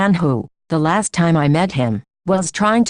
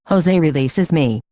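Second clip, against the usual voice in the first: plain reading voice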